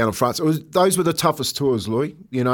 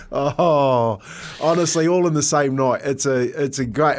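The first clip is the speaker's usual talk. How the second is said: normal talking voice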